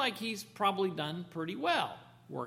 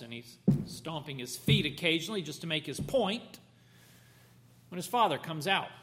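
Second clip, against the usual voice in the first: ordinary delivery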